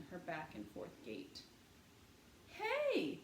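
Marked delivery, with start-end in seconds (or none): 2.5-3.2 put-on high child's voice calling out; a small girl warning her brother; high-pitched little sister calling out. A narrator's reading. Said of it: "said Honey".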